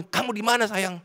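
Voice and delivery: a hint of a wife calling her husband; wife on phone. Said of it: "tiba-tiba istri saya telepon". (menirukan istri menelepon)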